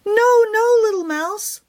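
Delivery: high urgent call. Acted. Hen calling down to stop the mouse, high and urgent. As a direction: cry out to stop her. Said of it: "Pumpkin called again".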